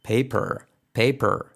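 "paper" with curled-back final R; tongue up to the palate on the R of "paper"; "paper" ending in a held American R. Shown as a demonstration. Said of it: "poner la lengua arriba en tu paladar".

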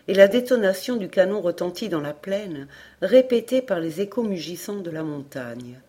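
ordinary delivery, nothing marked

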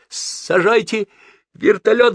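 loud, high, drawn-out order; panicked yell. A narrator's reading. Frantic passenger ordering the helicopter landed, yelling. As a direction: yell it slowly at the top of the range. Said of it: "заорал он".

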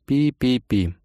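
spoken phone beeps; imitated beeps. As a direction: beep like a hung-up line. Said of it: "голосом автоответчика".

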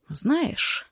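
soft, musing murmur; thoughtful mutter. A narrator's reading. Husband musing to his wife, thoughtful mutter. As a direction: mutter it pensively, under full voice. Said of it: "задумчиво пробормотал Форест".

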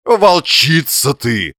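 high, slow, loud accusation; drawn-out whining tone. A narrator's reading. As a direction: moan it out in long syllables. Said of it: "в том же тягучем тоне".

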